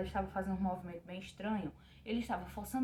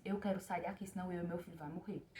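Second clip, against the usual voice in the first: retold crying plea; 3 semitones lower, 8 dB quieter than usual. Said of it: "comecei a chorar e disse".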